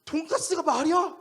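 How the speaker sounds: high, loud exasperated yell; angry outburst. Retold or imitated. Pastor losing patience with young man over pork cutlet, angry. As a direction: explode after holding it in. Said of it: "청년에게 화를 내기 시작했습니다".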